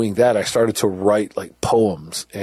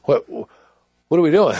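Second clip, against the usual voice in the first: higher, rising confusion that slows at the end; disbelief; 6 semitones higher, 3 dB louder than usual. Retold or imitated. Prisoner questioning guards on the walk to the block, baffled. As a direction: sound baffled at where they're taking you. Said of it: "and I'm like".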